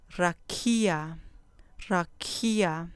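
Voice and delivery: "rakia" stressed on the KI; stress on penultimate syllable. Shown as a demonstration. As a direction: lean into ra-KI-a for "rakia". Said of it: "con acento en la penúltima sílaba". ("rakia" con acento en la penúltima sílaba)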